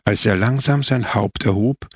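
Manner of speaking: usual speaking voice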